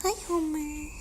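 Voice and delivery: normal talking voice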